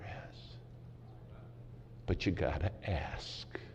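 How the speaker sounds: slow hushed whisper; a slow intimate closing whisper. Acted. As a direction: lean in and whisper the plea slowly. Preacher's closing plea to congregation.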